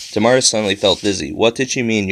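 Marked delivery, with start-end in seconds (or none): none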